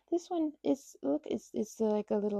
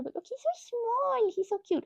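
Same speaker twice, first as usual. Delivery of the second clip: cooing; 13 semitones higher than usual. Real, not acted. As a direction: coo like at a tiny pet. Cooing at tiny plushie.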